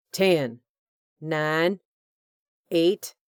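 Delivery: loud, menacing countdown. A narrator's reading. Hugh counting down on the radio, loud menacing countdown. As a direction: count them down like a threat.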